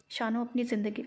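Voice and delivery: plain reading voice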